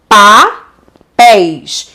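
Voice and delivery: "Papéis" split as pa... péis; "Papéis" in two separate, drawn-out beats; syllable by syllable. Shown as a demonstration. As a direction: say "Papéis" very slowly with a gap before péis. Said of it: "A separação de anéis é como".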